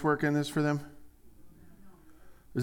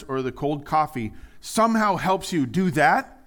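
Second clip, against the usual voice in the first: normal talking voice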